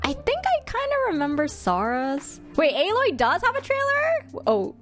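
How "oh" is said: ordinary delivery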